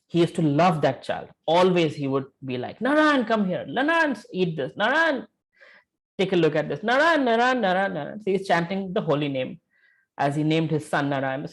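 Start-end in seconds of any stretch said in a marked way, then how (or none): 2.8-8.2 fond calling. Retold or imitated. Father fondly calling his son.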